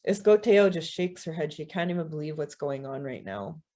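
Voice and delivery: ordinary delivery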